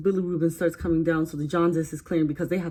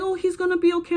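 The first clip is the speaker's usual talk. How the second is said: high-pitched imitation of comforting relatives; mock reassurance; 13 semitones higher, faster than usual. Family reassuring a worried new mother, imitated reassurance.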